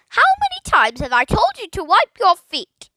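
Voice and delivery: high, loud squawking nag; scolding parrot. A narrator's reading. Parrot nagging Philip from the tree, loud squawk. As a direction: squawk it down from the branch.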